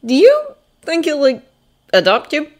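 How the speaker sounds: hesitant, broken-up asking; unsure. A narrator's reading. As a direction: feel your way through the question with pauses. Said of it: "as if not sure how to frame".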